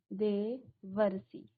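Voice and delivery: देवर्षि stretched out, syllable by syllable; slow dictation. Shown as a demonstration. Teacher dictating the answer slowly. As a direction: draw out देवर्षि so they can write it. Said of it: "यहाँ पर आप लिखेंगे".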